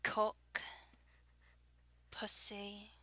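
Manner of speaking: put-on English accent; rounded vowels in a fake British accent. Shown as a demonstration. Reading a word list for an accent challenge, fake British accent. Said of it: "fake British accent, fake English accent".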